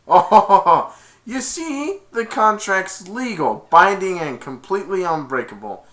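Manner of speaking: high theatrical voice opening on a laugh; gloating villain. Retold or imitated. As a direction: gloat over the victim, pitched high. Villain gloats over contract.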